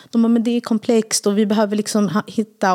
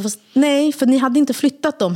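retold objection; 7 semitones higher, slower than usual. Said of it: "Marco bara".

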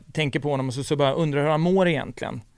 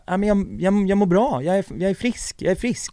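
retold friend, pitched higher with relief; light imitation of a friend, higher and brighter in pitch; relieved friend; 6 semitones higher than usual. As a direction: lift the voice as the relieved friend saying he's cured. Friend retold saying he's healthy.

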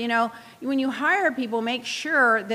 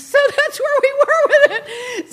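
laughing through the words, higher and louder; 11 semitones higher, 6 dB louder than usual. Real, not acted. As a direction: let the laugh carry the line. Laughing about ratings comment.

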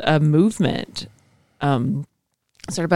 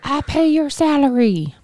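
high, indignant mock voter voice; entitled voter; 11 semitones higher than usual. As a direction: mimic a self-important taxpayer. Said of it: "Sounds a little bit like".

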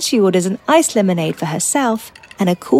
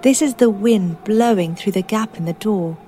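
guided meditation; 13 dB quieter than usual. Acted. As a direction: guide them gently into calm. Names sounds in the meditation.

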